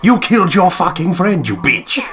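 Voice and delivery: furious shout. Acted. Voicing the heroine's guilty inner voice, screaming at herself. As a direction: scream it at yourself in self-hatred.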